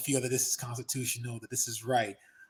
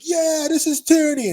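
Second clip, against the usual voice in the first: much higher, louder put-on voice of an angry protester; mock protester; 12 semitones higher, 9 dB louder than usual. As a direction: bellow it like a fired-up protester. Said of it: "and he's like".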